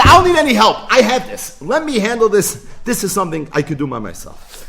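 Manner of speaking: loud high cocky claim; defiant boast. Retold or imitated. Bar Kochva telling Hashem he needs no help, loud boast. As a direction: declare it proudly, loud and high.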